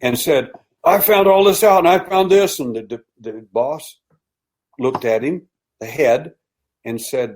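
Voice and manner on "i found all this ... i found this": much higher and louder, retold indignant outburst; angry. Officer confronting his boss after storming out, angry outburst. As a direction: blurt it at the boss like you've been wronged. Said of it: "he got mad and stalked out".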